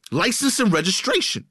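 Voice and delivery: mock yell. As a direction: bark it like a man losing it. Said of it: "he just starts yelling".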